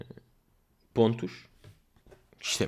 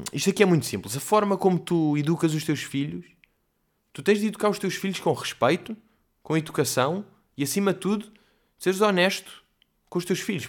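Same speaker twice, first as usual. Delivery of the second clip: imitating assertive interviewee; 6 semitones higher than usual. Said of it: "Ele estava mesmo com aquele discurso".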